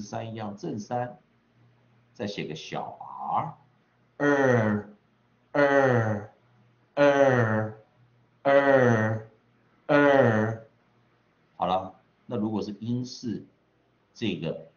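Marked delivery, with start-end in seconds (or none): 4.2-10.7 loud, held 儿, higher and evenly falling; long vowel demo. Shown as a demonstration. Teacher modelling the long r vowel for students, loud drawn-out 儿. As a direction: drill 儿 as one long r-coloured vowel, pausing after each. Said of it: "就是念".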